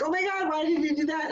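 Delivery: high, fast outburst at a driver who cut in; mock road-rage yell; exasperated shout. Retelling being cut off in the parking lot, high fast shout. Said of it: "And I'm like".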